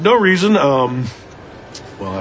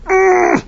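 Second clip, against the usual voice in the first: high squeal; 4 dB louder than usual. Acted. Squeal after triumphant moment.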